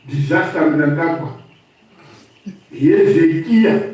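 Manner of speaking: ordinary delivery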